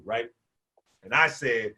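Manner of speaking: normal talking voice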